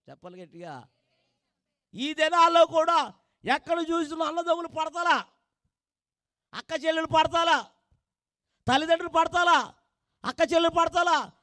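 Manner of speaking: full-force preaching. Acted. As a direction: drive the sermon home to the congregation. (గట్టిగా ప్రసంగం)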